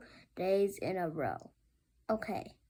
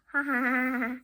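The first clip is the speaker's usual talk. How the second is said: hero laugh; 3 semitones higher, 4 dB louder than usual. Acted. Hero laughs after boasting.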